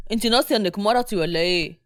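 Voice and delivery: low-pitched stern man; angry. A narrator's reading. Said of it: "ياسين بغضب". (بغضب وبصوت أغلظ)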